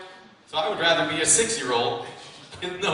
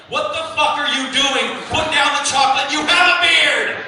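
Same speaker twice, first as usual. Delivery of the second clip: sharp, loud, higher self-scolding that slows on the last words; a loud, high inner voice barking orders; 7 semitones higher, 6 dB louder than usual. Retold or imitated. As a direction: yell at yourself to snap out of it. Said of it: "there's this voice in my head like".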